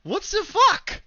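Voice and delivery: high, slow shocked disbelief. Real, not acted. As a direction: blurt it out in disbelief. GM reacts to undead dragon.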